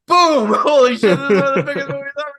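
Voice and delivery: high, loud burst of mock excitement; over-the-top hype. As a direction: shout it like a box-office smash. Mock hype again, sequel.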